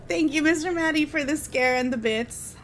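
ordinary delivery

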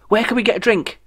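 excited. Acted. Jeff giddy, voiced high.

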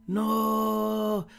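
long, high, sustained "no"; disbelieving no. Real, not acted. Moved reaction to character's care.

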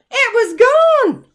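high, loud, slow cry of dismay; spring the shock on the listeners; shocked reveal. A narrator's reading. Suspense reveal after long pause.

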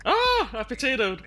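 loud high yelp; startled yell. Real, not acted. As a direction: cry out in sudden dismay. Dies again in game.

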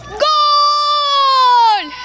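goal scream; screaming football commentator. Acted. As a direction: yell GOL at the top of your lungs.

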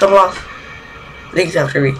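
usual speaking voice

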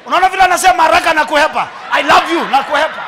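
gleeful mocking shout; excited laughing jab. Real, not acted. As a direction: crow with laughter at the hurried dodge. Laughing pastor mocks neighbour.

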